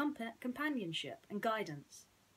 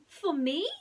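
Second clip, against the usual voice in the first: high, bright little mouse voice; squeaky high mouse greeting his friend; Timothy the mouse; 7 semitones higher, 9 dB louder than usual. Acted. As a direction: play a tiny cheerful mouse.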